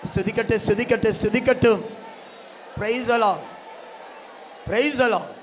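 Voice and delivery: high, loud revival shouting; full-force preaching. Real, not acted. Preacher urging congregation to praise aloud, shouted at full force. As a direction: rouse the crowd with ringing, high-pitched cries.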